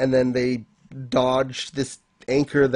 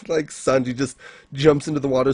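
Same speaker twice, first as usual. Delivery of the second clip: normal talking voice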